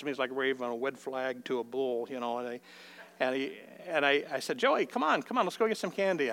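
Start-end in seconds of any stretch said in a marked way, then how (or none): 4.6-6.3 bright, higher coaxing; coaxing call. Retold or imitated. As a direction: coax the boy out cheerfully. Grandpa coaxing sulky grandson.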